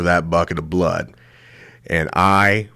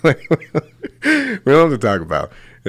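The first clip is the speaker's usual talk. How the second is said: laughing reassurance; 6 semitones higher than usual. Retold or imitated.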